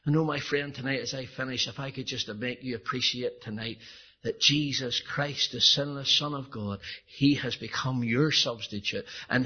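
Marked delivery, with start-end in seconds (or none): none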